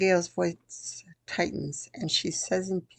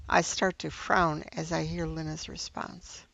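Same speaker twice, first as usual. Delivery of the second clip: ordinary delivery, nothing marked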